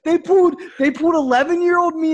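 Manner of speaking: high and loud, words breaking into laughter; can't get the story out for laughing; laughing through it. Real, not acted. Telling friends about being sent home, laughing.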